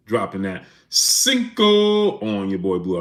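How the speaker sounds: slow, drawn-out hype call; shoutout catchphrase. Acted. Streamer thanking a donor, slow announcer drawl. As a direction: stretch it out like an announcer.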